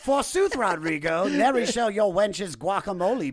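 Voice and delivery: put-on high, theatrical old-timey voice; hammy stage actor. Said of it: "What was the Renaissance like in Mexico?".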